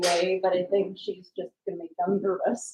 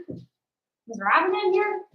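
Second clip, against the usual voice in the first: higher, slow drawn-out "here"; dog's voice, drawn out; 11 semitones higher, slower than usual. Retold or imitated. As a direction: stretch "here" out like an eager puppy. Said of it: "He's like".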